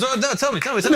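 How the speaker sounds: high-pitched begging imitation; hammed-up nosy pleading; mock eager pleading. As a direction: coax excitedly, high and insistent. Acting out friend begging for story.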